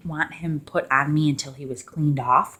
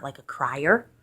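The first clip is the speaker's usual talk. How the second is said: ordinary talk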